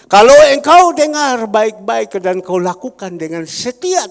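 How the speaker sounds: loud, ringing pulpit proclamation; a pastor booming scripture at his congregation; preaching at full force. Acted. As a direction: thunder God's promise to the church. Preaching God's word loudly.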